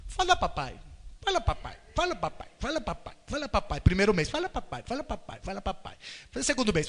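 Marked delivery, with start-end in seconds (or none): 0.1-3.8 softer, rapid-fire repeated coaxing; eager father coaxing. Acted. Father nagging his newborn to say papai, soft coaxing. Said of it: "imagina um pai que o neném acabou de nascer". 4.3-6.0 softer, rapid-fire repeated coaxing; eager father coaxing. Acted. Father nagging his newborn to say papai, soft coaxing. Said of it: "imagina um pai que o neném acabou de nascer".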